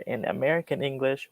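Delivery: usual speaking voice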